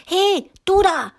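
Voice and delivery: put-on high, loud child's shout; little girl calling out loud; Lena shouting. A narrator's reading. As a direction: yell at the giant to stop. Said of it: "schrie Lena".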